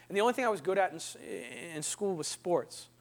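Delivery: ordinary talk, nothing marked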